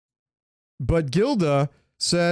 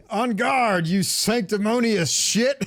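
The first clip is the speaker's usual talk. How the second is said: Penguin quote; 7 semitones higher, slower than usual. Retold or imitated. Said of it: "the Penguin always gets the best lines".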